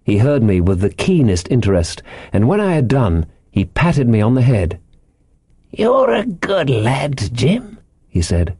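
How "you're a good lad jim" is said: put-on high, slow old sailor's yarn; eccentric castaway, confiding. A narrator's reading. Ben Gunn's voice.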